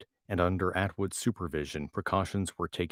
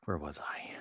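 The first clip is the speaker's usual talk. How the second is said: whisper; 3 semitones lower, 9 dB quieter than usual. Real, not acted. Aside after clearing throat.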